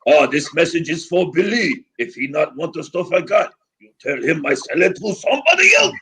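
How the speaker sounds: high-pitched mock French accent; hammed-up French dealer leaving an indignant message; Pierre. Dealer's message for Billy on the answering machine, thick French accent. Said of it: "thick of a dickhead French accent".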